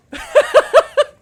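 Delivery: high, loud cackle; burst of laughter. Real, not acted. Host watching the hit replay, bursting into laughter. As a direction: let out a big laugh.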